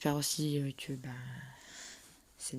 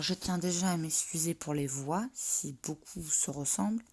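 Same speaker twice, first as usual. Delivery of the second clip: ordinary delivery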